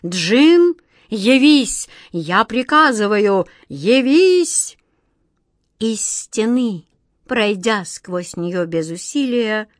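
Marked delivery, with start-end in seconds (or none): 0.0-4.8 loud royal summons; king yelling for his Djinn; shouting. Acted. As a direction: bellow the command. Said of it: "и снова крикнул".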